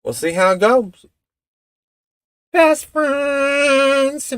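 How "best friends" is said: high falsetto sing-song; mock high catchphrase voice; put-on catchphrase. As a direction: squeal it like a catchphrase.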